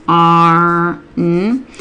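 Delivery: BARN segmented into B, long AR, N; finger-spelled sounds. Shown as a demonstration. Tutor modelling say and write barn, sounds stretched and separated. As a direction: sound out "barn" slowly, holding each sound apart. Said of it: "we could have them finger spell barn".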